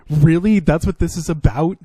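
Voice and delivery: loud, incredulous really. Real, not acted. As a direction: hit it with real disbelief. Incredulous repeat, louder.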